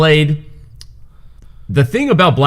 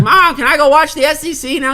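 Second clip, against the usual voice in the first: very high-pitched imitation kid; put-on high child's voice; kid asking mom. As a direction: pipe up like a child pestering mom. Acted child asking their mom.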